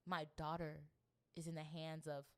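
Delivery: low, measured, mildly sorrowful; subdued grief. Acted. Voicing the queen who lost her family, understated grief. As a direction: lay out each loss quietly, with pauses. Voices the grieving queen's reasons.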